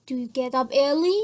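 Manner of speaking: "Do you get up early?" with the pitch lifting on "early"; a yes-no question that rises on "early"; rising intonation. Shown as a demonstration. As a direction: let "early" climb up at the end of the question. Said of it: "our voice must goes up".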